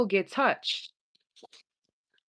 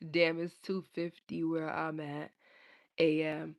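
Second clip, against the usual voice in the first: ordinary delivery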